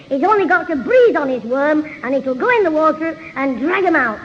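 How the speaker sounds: normal talking voice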